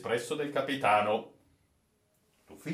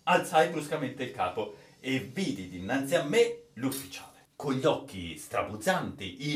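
ordinary delivery

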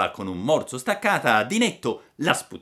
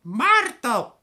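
a high, clipped echo of death; second robber repeating the threat; the other murderer; 4 dB louder than usual. Acted. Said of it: "disse l'altro".

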